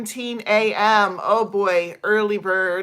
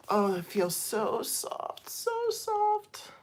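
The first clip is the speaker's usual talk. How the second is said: soft gushing; 2 semitones higher, 6 dB quieter, slower than usual. Retold or imitated. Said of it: "and I was like".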